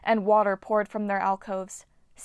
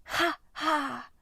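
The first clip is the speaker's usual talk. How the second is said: echoed laugh; 7 semitones higher than usual. Acted. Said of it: "the other eight echoed".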